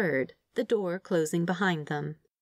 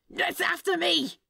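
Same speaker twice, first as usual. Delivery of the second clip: much higher, slow hammed-up panic; panicked cry; 11 semitones higher than usual. A narrator's reading. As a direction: wail like he's being hunted. Demon fleeing the Roomba.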